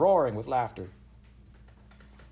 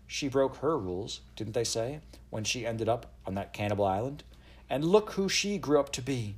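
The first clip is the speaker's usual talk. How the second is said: usual speaking voice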